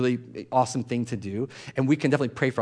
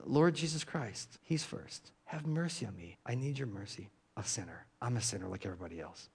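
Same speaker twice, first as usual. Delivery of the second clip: very soft, almost whispered, sinking lower; humble hushed prayer; 3 semitones lower, 12 dB quieter than usual. Shown as a demonstration. Preacher praying the Jesus prayer as a confession, hushed and humble. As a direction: pray it from the heart, barely above a breath. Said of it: "heartfelt prayer of humility".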